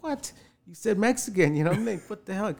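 high, incredulous "What?"; indignant disbelief. Retold or imitated. Rejected caller to casting line, high indignant disbelief.

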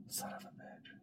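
whispered curse. Retold or imitated. Cursing a mosquito bite under his breath, whispered. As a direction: spit it out in a whisper.

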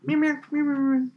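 high string of mock meows; cat meows. Joining the cat story with high meows. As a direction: meow like a needy cat.

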